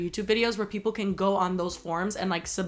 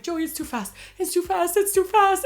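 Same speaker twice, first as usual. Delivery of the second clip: rising to a high, loud panicked yell; panicked scream; 10 semitones higher, 4 dB louder than usual. Retold or imitated. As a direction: shriek it louder each time. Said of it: "I'm screaming".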